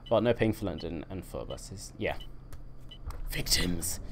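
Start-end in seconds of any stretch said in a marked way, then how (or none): none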